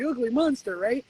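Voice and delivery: ordinary talk, nothing marked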